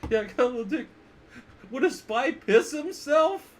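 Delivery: high, loud whiny boasting voice, slowing on the second line; Bill Paxton quote. Retold or imitated. Teacher quotes a sleazy movie line, high and whiny. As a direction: whine it like a cowardly braggart caught out. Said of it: "remember when he's talking about".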